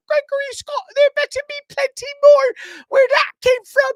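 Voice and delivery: very high, loud, shrill-pitched mother scolding; over-the-top high mom voice, half-shouted; nagging mom voice. Retold or imitated. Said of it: "that voice".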